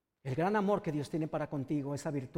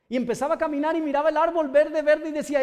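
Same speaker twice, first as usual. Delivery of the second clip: louder, higher impassioned preaching; preaching at full force; 9 semitones higher, 9 dB louder than usual. Acted. Preacher tells the congregation of Bartimeo, fervent loud preaching. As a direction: build the sermon to a fervent climax.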